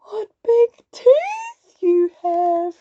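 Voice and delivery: ordinary delivery, nothing marked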